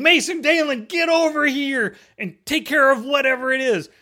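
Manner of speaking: put-on mom calling the boys, high-pitched; imitated yelling. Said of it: "She starts yelling".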